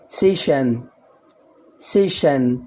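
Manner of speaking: سيشن said seshen with sh; sh in session. Shown as a demonstration. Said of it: "يبقى الكلمة إيه".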